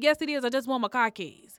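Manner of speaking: shouting up for her car keys; insistent yell at the door; retold yell. Yelling at boyfriend through door.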